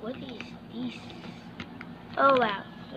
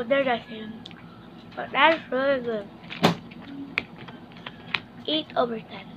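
talking while eating; chewing candy. Real, not acted. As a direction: mouth partly full. Seen 1: Hand at mouth, cheek bulging, lips working while tasting candy.

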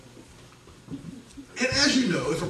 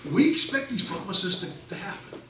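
ordinary talk

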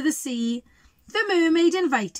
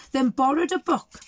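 ordinary delivery, nothing marked

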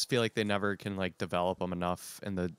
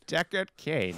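higher-pitched mock old guy voice; Deckard Cain; 6 semitones higher, slower than usual. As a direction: echo the old man's self-introduction. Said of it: "do an old guy voice".

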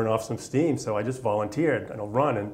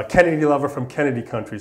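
imitating a sergeant's taunt, louder and higher; hint of a Southern drill sergeant's taunt; mocking sergeant; 4 semitones higher, 6 dB louder than usual. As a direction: tease the Boston recruit the sergeant's way. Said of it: "is real Southern, real hardcore, gravelly voice, loud".

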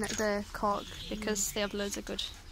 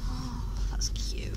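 whispering; slower than usual. Real, not acted. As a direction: breathe it out gently. Hushed over cute animal.

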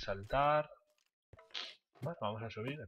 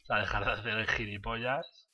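ordinary talk

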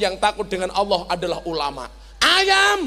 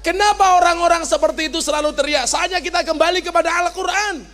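mock yell; 7 semitones higher, faster than usual. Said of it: "Kula jawab".

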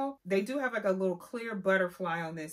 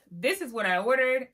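higher and louder, expectant build-up; hyped-up setup; 4 semitones higher, 6 dB louder than usual. Acted. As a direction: sell the dream of the order. Ordered vs got meme contrast.

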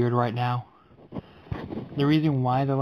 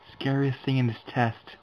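ordinary talk

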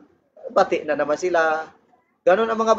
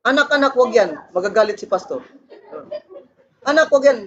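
parent scolding; 6 semitones higher, faster than usual. Retold or imitated. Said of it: "yung pastor ang panakot".

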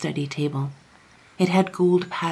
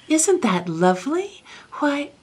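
delighted wonder; 10 semitones higher than usual. A narrator's reading. Young king admires painted butterfly.

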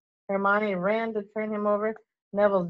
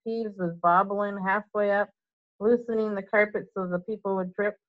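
ordinary delivery, nothing marked

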